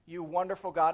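soft, low, mock-pious prayer; hushed formula prayer, lightly parodied; dutiful prayer. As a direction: put on a polite, dutiful praying voice. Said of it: "I go to prayer and I go like this".

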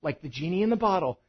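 normal talking voice

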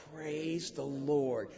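mean it, not loud; quiet conviction. Shown as a demonstration. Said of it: "say it with conviction and not loud".

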